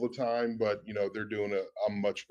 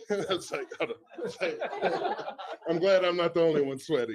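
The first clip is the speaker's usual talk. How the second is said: laughing; 8 semitones higher than usual. Real, not acted. Laughing at nervous sweating.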